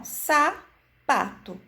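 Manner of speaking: sapato drawn out slowly; slow sounding out. Shown as a demonstration. Teacher to a child who cannot read, slow and clear. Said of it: "enfatizando cada sílaba".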